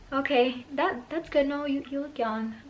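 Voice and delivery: high, uneasy placating voice; nervous humouring. Retold or imitated. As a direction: humour him timidly, pitched well up. Humouring the creepy driver nervously.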